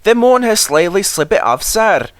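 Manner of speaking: high Scottish engine voices; Scottish twins. A narrator's reading. Twins answer the Fat Controller in a Scottish brogue. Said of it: "spoke in chorus".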